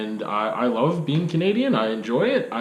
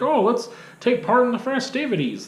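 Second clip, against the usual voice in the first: high, sing-song mock enthusiasm; pitched way up, sarcastic; mock festive cheer; 11 semitones higher than usual. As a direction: parody someone eager to party. Mocking people who celebrate.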